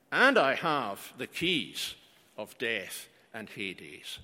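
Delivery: 1 slow solemn fade. Acted. Scripture quote, slowing and fading.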